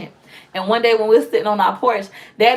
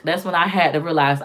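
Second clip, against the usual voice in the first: ordinary talk, nothing marked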